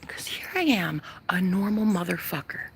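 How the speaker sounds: breathy confiding whisper. Real, not acted. Vlogger confides in viewers about her body, whispering. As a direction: lean in and whisper it.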